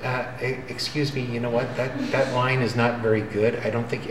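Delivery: a timid whisper of a conscience apologizing; shy, barely-there brain voice; quiet meek inner voice. Retold or imitated. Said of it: "it's very quiet and it's very meek".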